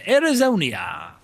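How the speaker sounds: drawn-out, pompous mangling of a place name; Matt Berry. Pretend. Streamer names home state, Matt Berry impression. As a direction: swell slowly through "Arizonia". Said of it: "doing my Matt Berry impression".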